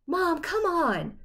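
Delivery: slow, high whining protest; whiny protest. Acted. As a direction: drag it out in protest. Son protesting mother's deadline.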